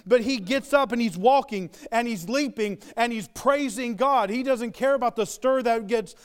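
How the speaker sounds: normal talking voice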